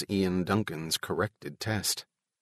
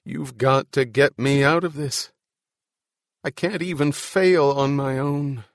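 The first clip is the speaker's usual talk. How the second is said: pitched-up pleading to himself; desperate plea; 5 semitones higher, 4 dB louder than usual. Acted. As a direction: plead harder, voice lifted.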